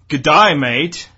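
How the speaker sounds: slow, loud attempt at an Australian accent; a bad Australian accent, drawn out; mock Australian greeting. Shown as a demonstration. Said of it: "I only can do Rupert".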